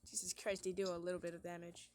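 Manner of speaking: usual speaking voice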